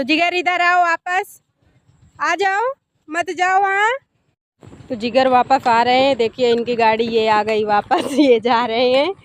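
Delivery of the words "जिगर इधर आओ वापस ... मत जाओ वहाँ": calling out. Real, not acted. Calling Jigar back from wandering off, higher-pitched calling out. Mother calls child back.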